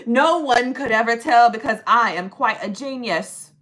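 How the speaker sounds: loud, grandiose fake genius; mock boast. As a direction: proclaim your brilliance with hammed-up pomp. Mock boast about pausing time.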